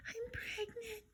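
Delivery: put-on very high, soft childlike voice; little baby voice. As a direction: say it sweetly like a little girl. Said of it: "her little baby voice".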